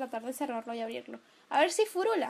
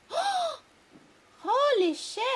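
high gasp into a raised exclamation; real jolt of shock, pitched way up; gasp, then swear in alarm; startled; 11 semitones higher, 4 dB louder, much slower than usual. Real, not acted. Startled by something in game.

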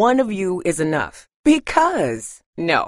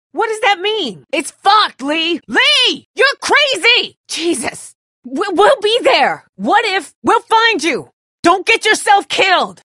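much higher, loud desperate yelling across a gap; frantic shouting; 8 dB louder than usual. Acted. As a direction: scream it over to him in panic. Calling across to Lee in danger.

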